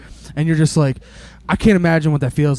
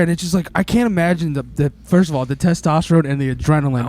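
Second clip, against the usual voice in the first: ordinary talk, nothing marked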